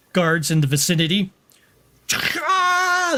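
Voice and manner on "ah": high, drawn-out wail; mock crying wail. Game master acts out the shocked guard, mock crying wail. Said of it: "this guy starts crying".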